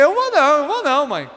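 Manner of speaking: high-pitched, scared refusal; frightened child. Retold or imitated. Son afraid of his angry mother, frightened refusal. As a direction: refuse like a scared kid, voice jumping up. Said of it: "Eu já ficava com medo".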